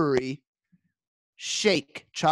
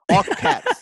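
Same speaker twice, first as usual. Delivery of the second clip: all caps, called out much higher and louder; shouted punchline; 9 semitones higher, 7 dB louder than usual. Real, not acted. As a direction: blurt it out loud and high. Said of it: "Bob's text in capitals".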